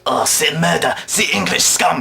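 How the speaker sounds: mock-outraged French accent. French people react to the paper aeroplane, mock French accent. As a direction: complain in a put-on French accent. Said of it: "lands in France".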